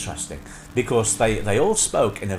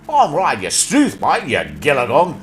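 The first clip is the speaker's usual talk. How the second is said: loud, broad early-soap Australian; hammed-up Australian cobber; Australian accent; 7 dB louder than usual. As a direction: belt out a caricature Australian greeting. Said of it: "a very slight accent in there".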